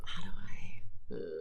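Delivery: low, slow whisper while searching for words; real hushed self-questioning. Real, not acted. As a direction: think aloud under your breath. Searching for words, talking to herself.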